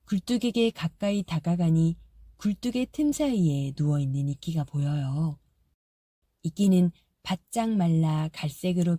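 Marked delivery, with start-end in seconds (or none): none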